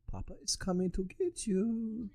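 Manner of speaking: put-on high, soft, slow German-accented tease; mock-creepy German singsong, hushed and high; movie German accent. Papa coming to get Valheim, soft high German accent. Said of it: "movie version of a, of a German accent".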